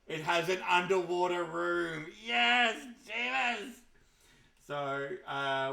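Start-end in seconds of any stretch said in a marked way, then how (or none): 0.1-3.7 high loud cheer; excited shout. Real, not acted. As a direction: cheer the big reveal. Excited reveal of underwater room.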